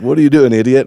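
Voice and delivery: lightly acted outrage; mock indignant. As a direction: voice a dog fed up with its owner. Dog voiced, scornful at owner.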